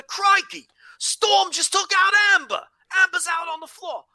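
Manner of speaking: crikey-first Australian commentator, yelled out; Australian accent. Retold or imitated. Host redoes the call in an Australian accent with crikey first. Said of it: "if you're ever gonna do an Australian accent".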